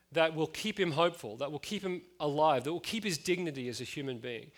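ordinary talk

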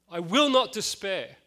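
the vow said again, stronger; rising resolve. Retold or imitated. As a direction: repeat the vow with growing force. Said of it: "there's this determination".

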